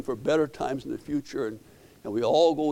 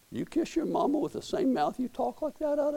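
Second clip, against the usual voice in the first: daughter's rebuke; 12 semitones higher, 4 dB quieter than usual. Retold or imitated. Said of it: "My daughter ... said".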